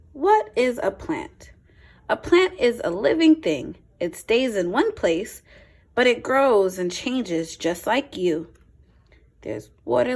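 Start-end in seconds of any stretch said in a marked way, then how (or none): 0.1-8.5 clear, lively picture-book read-aloud; a teacher's storytime voice, a touch brighter; reading to children. Acted. Reads picture book to class.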